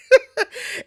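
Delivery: sudden high laugh; high burst of laughter. Real, not acted. Cracking up after quoting the creepy vow, a high laugh. Laughing at her own line.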